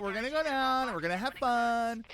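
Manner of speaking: Valley Girl accent played for laughs. Shown as a demonstration. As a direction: ham up a mall-going Valley Girl accent. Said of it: "that's the Valley Girl accent".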